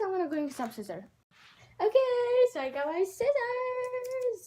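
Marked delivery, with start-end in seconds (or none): 1.8-4.4 higher and louder, gushing; bubbling over with glee; excited. Real, not acted. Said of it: "Sorry, I'm so excited".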